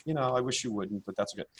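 soft, noncommittal mumbling imitation; mock timid believer. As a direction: play someone backing away from their own belief. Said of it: "standing back in the corner and going".

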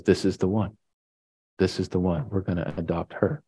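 softly, almost under the breath; a hushed, certain decision; quiet. Retold or imitated. Father quietly deciding on the girl.

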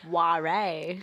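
"Ware" as loud two-syllable war-ray; "Ware" echoed as WAH-ray; old spelling pronounced. Shown as a demonstration. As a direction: sound the final E of "Ware" as ay. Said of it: "War was initially spelled W A R R E".

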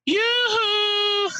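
very high, loud sing-song yoo-hoo; old lady cheering a bingo number; bingo hall call. Retold or imitated. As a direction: trill it like a bingo lady calling out. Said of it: "someone rings a bell, like".

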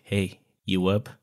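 ordinary talk

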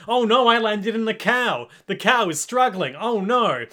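hammed-up helpless villain, drawn out; mock panic. Stand stuck in a struggling cow on the boat, mock panic.

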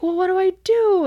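high, loud retold plea; exaggerated what-now wail; mock helpless fretting. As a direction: act out being at a total loss. Acts out Katza at a loss.

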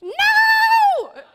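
mock banshee scream. Mother at her kids, mock screaming banshee. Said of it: "full-fledged screaming banshee".

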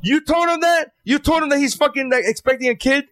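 stunned, scolding the wife in disbelief; outraged disbelief. Retold or imitated. Husband scolding his wife, high and incredulous. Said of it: "I'm like".

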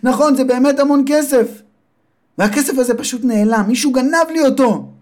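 high, upset mother's voice. Acted. Mother tells her son the money is gone, high and upset. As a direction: wail it like a robbed mother.

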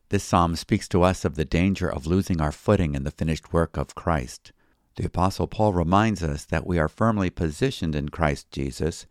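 ordinary talk

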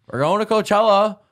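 imitating housemates' loud, high-pitched holler; excited shout. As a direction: holler it high like a hyped-up wake-up call. Housemates waking him, retold.

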